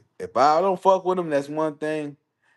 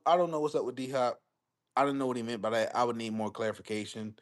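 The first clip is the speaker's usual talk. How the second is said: usual speaking voice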